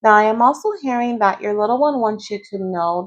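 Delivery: ordinary delivery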